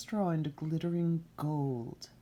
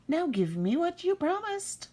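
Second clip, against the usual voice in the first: high squeaky demand from an imp; high impish demand; little man's voice; 13 semitones higher than usual. Acted. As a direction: claim the prize in a goblin squeak. Little man demands child.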